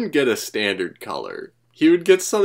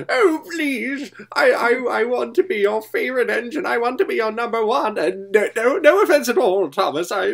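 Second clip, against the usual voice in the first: very high, pleading put-on voice; grovelling; 14 semitones higher than usual. Class forty to the Fat Controller, grovelling. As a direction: beg desperately, pitch way up. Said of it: "like, groveling".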